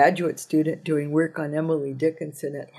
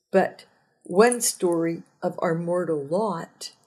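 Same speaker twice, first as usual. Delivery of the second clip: ordinary talk, nothing marked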